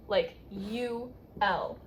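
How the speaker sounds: usual speaking voice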